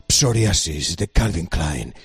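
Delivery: low, slow, sultry ad announcer; perfume ad voice. Shown as a demonstration. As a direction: purr it like a cologne commercial. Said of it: "tienes que hacerlo así".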